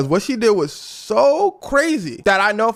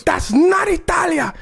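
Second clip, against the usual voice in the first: a shouted protest, higher and punched on not; mock shout; 7 semitones higher than usual. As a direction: yell it like a rallying cry. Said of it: "one of the dudes goes".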